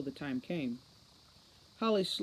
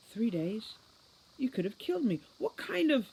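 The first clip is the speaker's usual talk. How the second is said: horrified disbelief; 7 semitones higher than usual. A narrator's reading. Holly horrified at the lie.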